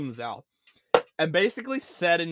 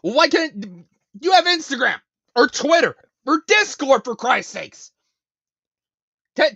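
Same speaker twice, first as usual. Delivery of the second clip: higher, louder exasperated rant; scolding a viewer whose phone broke, exasperated and loud; real frustration; 8 semitones higher, 7 dB louder than usual. Real, not acted. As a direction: hammer each app name in exasperation. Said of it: "you see how visibly frustrated I am".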